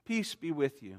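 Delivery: soft, tender blessing, falling gently. Acted. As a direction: speak peace softly, like comforting a frightened friend. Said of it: "Jesus is so tender to them".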